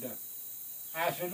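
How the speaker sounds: ordinary delivery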